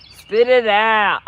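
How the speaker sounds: slow, loud, drawn-out mock impatience; mock-telling a fast talker to get to the point; impatient Southerner. Mocking how Tennesseans react to fast talk, slow and loud. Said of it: "if you go down to Tennessee, it's like".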